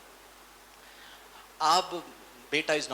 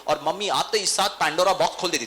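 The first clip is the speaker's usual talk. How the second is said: ordinary talk, nothing marked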